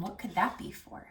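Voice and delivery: usual speaking voice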